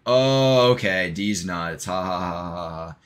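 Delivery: loud drawn-out "oh", then slow fake laugh; hammed-up know-it-all dad butting in; interrupting mock guffaw. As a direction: stretch it out, then a mocking ha-ha. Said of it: "dad comes out of left field".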